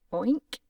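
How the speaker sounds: a loud, rising oink like a pig. Acted. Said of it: "the doctor replied".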